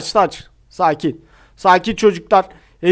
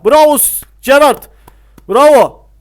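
excited cheer; 5 semitones higher, 6 dB louder than usual. Real, not acted. Cheering his player's play.

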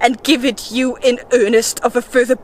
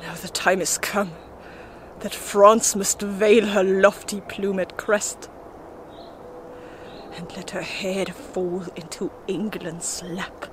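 hushed resignation; 3 semitones lower, 7 dB quieter than usual. Acted. Forsaken by spirits, foreseeing France's fall, hushed resignation. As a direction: sink into quiet defeat, voice lowered. Abandoned, accepts defeat of France.

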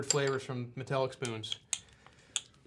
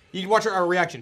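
ordinary talk, nothing marked

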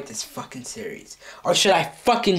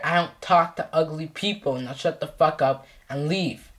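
ordinary talk, nothing marked

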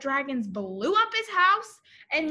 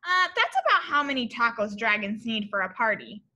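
usual speaking voice

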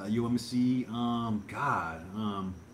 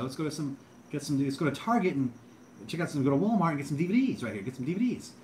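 higher, stumbling vlogger voice; hammed-up video intro, pitched up; Cool Dude; 4 semitones higher than usual. Showing viewers DVD buys, higher Cool Dude impression. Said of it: "Cool Dude impression".

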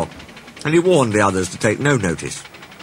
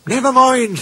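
very high-pitched little engine; cheering-up voice; 4 dB louder than usual. A narrator's reading. Rusty consoling Skarloey, high reassuring voice.

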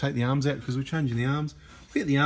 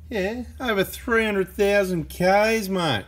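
impressed boast; 8 semitones higher, 4 dB louder, much slower than usual. Real, not acted. Bragging about shock absorber mileage.

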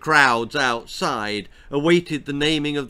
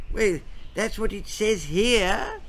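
put-on higher, helpless old man; explaining old king; 8 semitones higher than usual. A narrator's reading. Weak-eyed king explaining to his family. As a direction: shrug it off feebly. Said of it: "explained the weak-eyed monarch".

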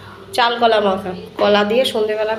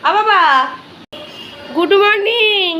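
শিশুকে আদুরে সম্ভাষণ; 8 semitones higher, slower than usual. Real, not acted. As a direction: coo brightly to a little one, drawn out and high. Mother greets waking child. (আদুরে চড়া গলায় টেনে বলা)

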